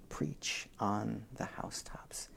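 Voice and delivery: hushed whisper of the verse; whispered scripture. Shown as a demonstration. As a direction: breathe the words like a secret. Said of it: "how quiet I said that".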